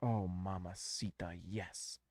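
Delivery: contented purr; low satisfied murmur. Real, not acted. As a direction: relish it under your breath. Savoring the pick.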